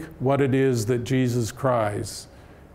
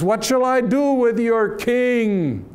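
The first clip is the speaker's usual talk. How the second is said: high, raised voice of Pilate; Pilate's question; 8 semitones higher, 3 dB louder than usual. Retold or imitated. Governor Pilate asks the multitude, raised pitch. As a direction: Pilate calling to the crowd, half-mocking.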